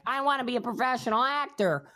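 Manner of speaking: mocking quote. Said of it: "some bullshit answer like".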